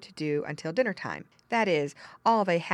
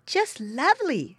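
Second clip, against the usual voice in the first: put-on high-pitched girlish admiration; admiring girl; 10 semitones higher, 4 dB louder than usual. Second girl admiring pigs, pleased. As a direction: coo like a pleased little girl.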